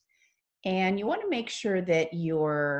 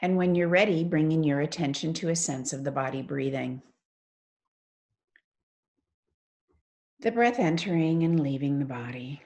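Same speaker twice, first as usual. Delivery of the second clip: guided meditation. Acted. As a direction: ease them into stillness, phrase by unhurried phrase.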